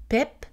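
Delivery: pep with a relaxed short i, almost an e; pep, the lax Québec pipe; lax vowel. Shown as a demonstration. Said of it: "le mot pipe se prononce".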